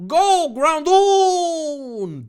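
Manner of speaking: very high, drawn-out yell; hammed-up anime battle cry; battle command. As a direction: send your monster out with a huge holler. Dave sends Pokemon into battle.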